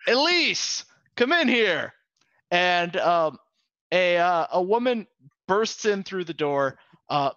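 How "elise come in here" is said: high, loud call into the next room; captain summoning his first mate, loud and high. Acted. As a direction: shout her name through the door. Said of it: "And he says".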